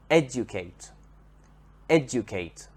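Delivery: "Educate" stressed on ED, falling away after it; stress on first syllable. Shown as a demonstration. Teacher repeats the verb for learners, first syllable of "educate" stressed. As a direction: model "educate" as ED-ucate. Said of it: "has the stress on the first syllable".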